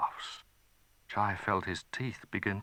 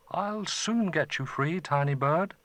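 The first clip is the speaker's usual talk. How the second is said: lightly voiced boy, higher; a kind young boy freeing the bird; eager boy; 8 semitones higher than usual. Chai speaking to the frozen bird, lightly voiced.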